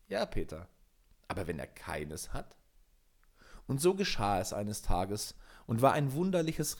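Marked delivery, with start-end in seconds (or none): 0.1-2.6 soft, lower, needling question; quiet doubting voice. A narrator's reading. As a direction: plant a doubt softly. Inner voice answers Peter's boast.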